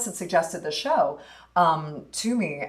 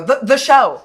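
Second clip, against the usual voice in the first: "show" loud and high, punched; emphatic clarifying; 6 semitones higher, 10 dB louder, slower than usual. Retold or imitated. Actor clarifying the play's title in conversations, emphatic. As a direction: restart on "the", then hit "show" hard. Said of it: "constantly having to go".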